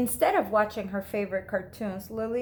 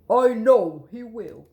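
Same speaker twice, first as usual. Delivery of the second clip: firm father; 8 dB louder than usual. Acted. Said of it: "said Lily's father".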